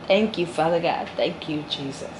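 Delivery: real, soft prayerful aside; hushed thanks. Real, not acted. Woman overcome with gratitude thanking God, hushed and fading. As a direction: let it sink away softly, overcome.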